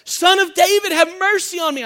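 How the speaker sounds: high, loud desperate cry. Acted. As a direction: shout it over the noise. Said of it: "I think it's louder".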